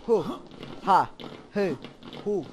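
short loud silly grunts, each one punched; goofy grunts. Real, not acted. Gamer blurting silly loud grunts at nothing. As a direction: bark out nonsense syllables for fun.

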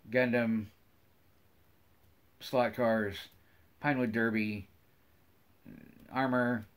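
ordinary delivery

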